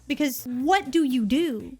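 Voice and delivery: usual speaking voice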